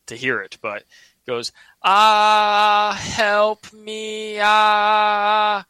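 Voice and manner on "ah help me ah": high, loud wail held on one pitch; one-tone scream for help. Retold or imitated. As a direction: reenact a drawn-out monotone cry for help. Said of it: "all in one tone".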